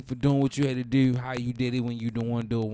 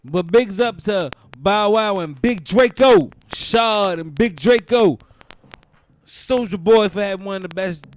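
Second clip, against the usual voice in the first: loud, slow shout-out; big loud shout-out to the homies; 5 semitones higher, 11 dB louder than usual. Real, not acted. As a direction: boom it out like a hype man.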